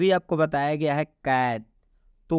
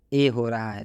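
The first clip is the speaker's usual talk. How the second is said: normal talking voice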